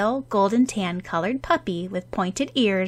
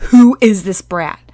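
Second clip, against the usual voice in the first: louder, irritated demand; dark demand; 11 dB louder than usual. Acted. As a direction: glare and demand who the kid is. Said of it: "With a dark expression ... and demanded".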